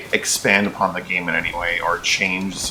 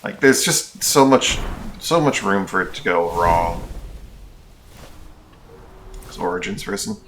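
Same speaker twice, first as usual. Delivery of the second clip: normal talking voice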